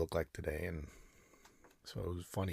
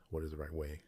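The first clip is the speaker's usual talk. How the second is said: ordinary delivery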